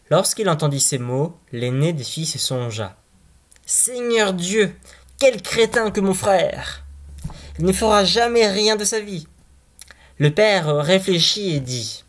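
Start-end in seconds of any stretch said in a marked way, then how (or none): 3.6-9.3 slowed, higher, sneering big brother; scornful elder brother. Acted. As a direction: scoff at the dim little brother.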